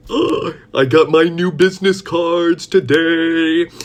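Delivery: high, loud, stretched-out brag; gasp, then sing out the big news slowly; gleeful announcement. Real, not acted. Shows off new cards proudly.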